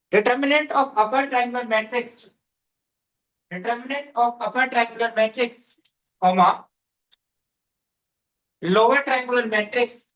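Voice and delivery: very slow, drawn-out words for note-taking; slow dictation. Real, not acted. Teacher dictating a matrix rule to students, slow dictation. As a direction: dictate phrase by phrase with long pauses.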